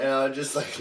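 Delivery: ordinary delivery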